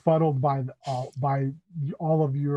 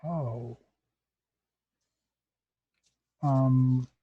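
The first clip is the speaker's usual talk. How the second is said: slow, halting, searching for words; hesitant Bliss; much slower than usual. Acted. Bliss nervously asking the party about the chapel, slow and halting. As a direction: pause and stumble toward the question. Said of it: "she kinda looks around and she's".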